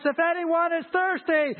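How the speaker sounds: proclaiming high and loud, slowing on the drink line; preacher voicing Jesus crying out loud at the feast. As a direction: call out to the whole crowd. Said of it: "said in a loud voice".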